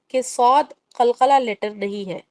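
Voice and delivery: ordinary delivery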